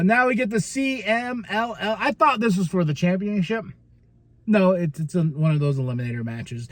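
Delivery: ordinary delivery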